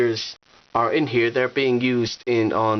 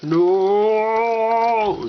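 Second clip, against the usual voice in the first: Gandalf yelling; 10 semitones higher, 5 dB louder than usual. Retold or imitated. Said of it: "Gandalf's spinning around like".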